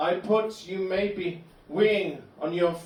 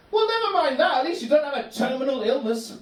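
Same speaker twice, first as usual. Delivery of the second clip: much higher, louder, sing-song cheerfulness; cheery friend Gary; 7 semitones higher, 3 dB louder than usual. Retold or imitated. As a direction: mimic an annoyingly happy Buddhist friend. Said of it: "dialogue with Gary".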